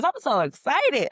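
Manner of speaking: giddy high squeal; high excited squeal. Pretend. Repeats line in two voices.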